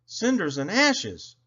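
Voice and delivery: slow, high-pitched exclamation of dismay; exclaiming. A narrator's reading. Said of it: "exclaimed Thomas".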